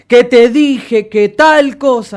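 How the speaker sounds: high, very loud, slow yell; over-loud, drawn-out aggressive repeat; demonstrated shout. Shown as a demonstration. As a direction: bellow it as if repeating to someone who didn't hear. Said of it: "en un grito".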